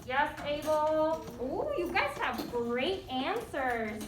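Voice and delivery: ordinary talk, nothing marked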